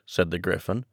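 ordinary delivery, nothing marked

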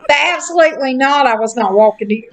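ordinary talk, nothing marked

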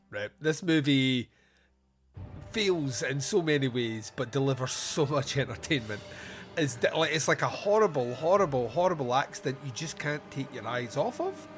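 ordinary delivery

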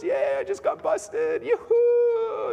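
very high, drawn-out mock cheer; hammed-up yahoo of joy; mock rejoicing. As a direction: celebrate absurdly, up in a squeal. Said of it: "not like you're gonna rejoice".

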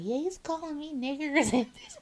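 high, laughing put-on voice of the coworker; mimicking coworker. Coworker reporting insults on the radio, high laughing voice. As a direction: play her telling it with a giggle. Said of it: "'Cause she's like".

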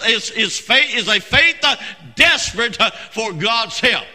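driving pulpit cadence, hard stresses; full-force preaching. Real, not acted. Preacher drawing lessons on great faith, forceful preaching.

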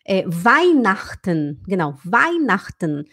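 slow Weihnachten with full vajnaxtən; careful German Weihnachten; correct Weihnachten. Shown as a demonstration. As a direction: say Weihnachten slowly and clearly. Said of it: "ele queria falar".

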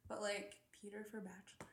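voiceless conspiratorial whisper. Real, not acted. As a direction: whisper it like a daring secret. Daring to say a hot take.

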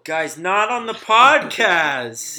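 slow, loud exasperated call-out; loud scolding. Real, not acted. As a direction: tell them off, loud and drawn out. Scolding friends during recording.

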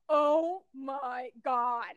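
very slow, drawn-out disbelief; imitated shock. Retelling her realizing it was Sarah, imitated shock. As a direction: stretch each word in mock astonishment.

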